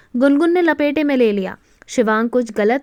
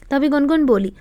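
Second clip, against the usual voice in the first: ordinary delivery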